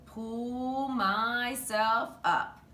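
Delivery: very slow, straining climb; straining effort. A narrator's reading. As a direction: drag the words out like hauling yourself up. Climbing the projector cart.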